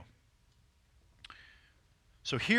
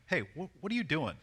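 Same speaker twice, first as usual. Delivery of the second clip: higher, halting mock suspicion; suspicious; 5 semitones higher than usual. Shown as a demonstration. Man teasingly accuses a woman of something, suspicious. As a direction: question her as if she's up to something. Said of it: "suspect her of something".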